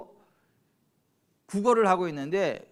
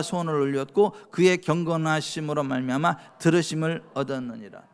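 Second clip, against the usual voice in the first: louder formal scripture reading; preacher reading Hebrews aloud; 3 semitones lower, 3 dB louder than usual. Acted. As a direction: proclaim the verse to the congregation.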